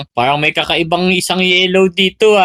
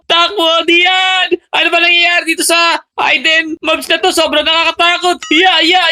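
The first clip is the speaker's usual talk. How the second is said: panicked screaming; 7 semitones higher than usual. Pretend. Fleeing a monster, screaming.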